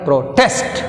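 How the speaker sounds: Protest said pro-TEST, test stressed and rising. Shown as a demonstration. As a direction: put the weight on test in protest. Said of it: "మనం ఎలా పలకాలి?".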